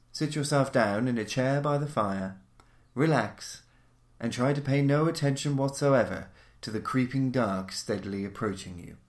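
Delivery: ordinary delivery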